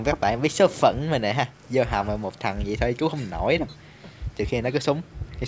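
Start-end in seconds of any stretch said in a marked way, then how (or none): none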